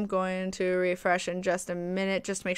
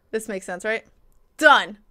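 ordinary talk, nothing marked

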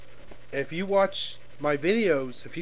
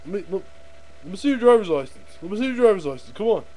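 bullying cop; 4 semitones higher than usual. Acted. Cop asking a driver for the license, pushy. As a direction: put on a pushy traffic officer. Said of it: "one of those big prick cops".

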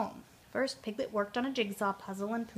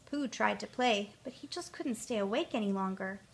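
ordinary delivery, nothing marked

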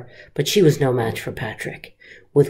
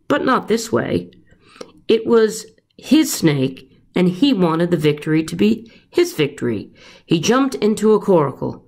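plain reading voice

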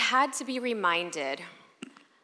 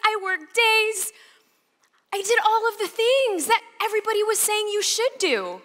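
much higher, louder whiny wail; self-pity wail; 7 dB louder than usual. Retold or imitated. Reliving her pity party, high and wailing. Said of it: "pity party of one".

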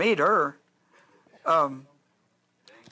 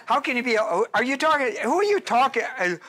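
normal talking voice

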